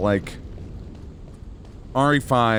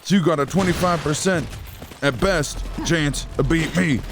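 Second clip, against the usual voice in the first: higher, emphatic wrestler promo voice; Scott Steiner; 6 semitones higher than usual. Acted. Wrestler working out the odds of beating him, higher promo voice. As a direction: rant the odds like a pro wrestler, pitched up. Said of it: "is Scott Steiner's math".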